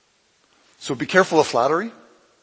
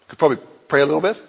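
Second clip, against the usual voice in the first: usual speaking voice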